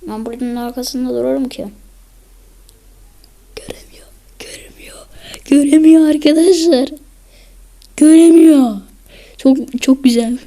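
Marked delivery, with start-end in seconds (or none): 3.6-5.0 hushed whisper, can't see me; sneaky whisper behind cover. Real, not acted. Whispering while hiding behind cover. 5.4-10.4 louder, higher gloating; triumphant hidden-player gloat; gleeful loud taunt. Real, not acted. Gloating to viewers while hidden.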